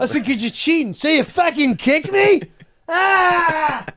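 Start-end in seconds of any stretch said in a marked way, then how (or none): 0.2-0.9 high, heated comeback; retold angry retort. Player accusing the castmate of cheating, retold heated retort. Acted quarrel over the kick. 0.9-2.4 high, indignant imitated protest; mock outrage. Kicked castmate challenging the kicker, mock indignant yell. Acted quarrel over the kick. 2.9-3.8 high mock cry of pain; imitated pain scream. Castmate kicked in the calf, mock pain cry.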